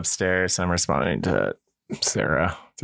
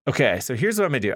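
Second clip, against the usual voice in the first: fast rehearsed; 4 semitones higher, faster than usual. Pretend. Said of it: "Say what you're gonna do".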